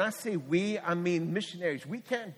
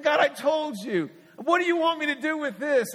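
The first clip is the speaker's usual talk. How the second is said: higher, louder, slowed complaint to God; exasperated plea to God; 9 semitones higher, 5 dB louder than usual. Shown as a demonstration. Preacher showing the congregation his frustrated cry to God. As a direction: throw up your hands and protest to God. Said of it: "This is how, like".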